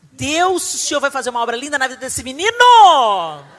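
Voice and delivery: loud, fervent declaration to God; fervent prayer. Retold or imitated. Mother declaring blessing over son, loud fervent prayer. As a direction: proclaim it over the boy.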